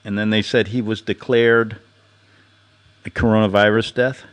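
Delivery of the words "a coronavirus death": ordinary talk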